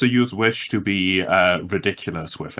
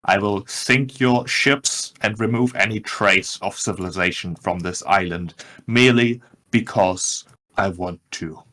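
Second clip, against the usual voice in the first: ordinary delivery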